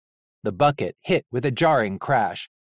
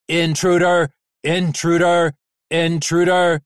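loud machine alarm bellow; 4 semitones higher, 6 dB louder than usual. Acted. Scrapyard machine bellowing at the intruder, loud. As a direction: boom it out like a siren. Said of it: "it bellowed".

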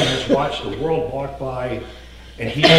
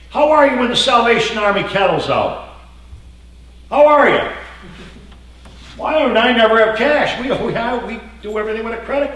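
shouting the question; loud preaching; 8 semitones higher, 6 dB louder than usual. Acted. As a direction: hammer the question home. Preacher mimicking passerby excuses.

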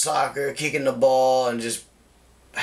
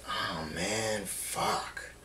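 quiet disappointed mutter; 4 semitones lower, 11 dB quieter, much slower than usual. Retold or imitated. Realizing she's on her period.

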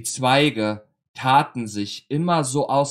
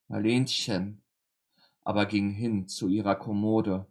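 ordinary delivery

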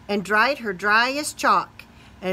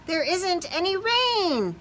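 happy spider; 9 semitones higher than usual. Acted. Spider glad at sunshine.

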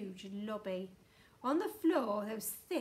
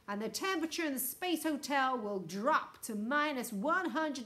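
loud, commanding loudspeaker threat; booming ultimatum; 2 semitones higher, 6 dB louder than usual. A narrator's reading. Houston threatens to freeze the hotel, booming and stern. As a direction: boom it out like a stern official warning. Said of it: "boomed the voice".

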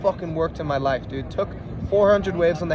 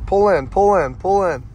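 cheering; 6 dB louder than usual. Real, not acted. Cheering a surfer into the barrel.

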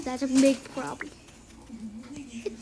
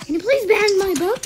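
louder, higher desperate plea; pleading shout; 6 semitones higher, 11 dB louder than usual. Real, not acted. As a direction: beg at the top of your voice. Begging, repeated louder.

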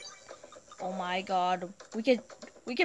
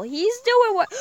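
higher and louder cheer for the lion; excited cheer; 11 semitones higher, 9 dB louder than usual. Real, not acted.

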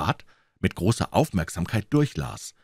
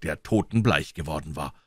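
usual speaking voice

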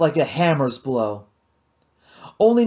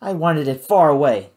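usual speaking voice

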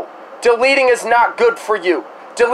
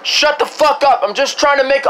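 ordinary talk

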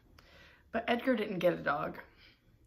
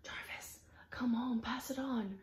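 whispered message; 5 semitones higher, 5 dB quieter than usual. Acted. Message passed along, whisperer.

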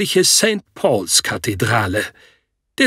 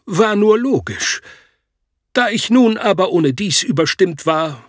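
ordinary delivery

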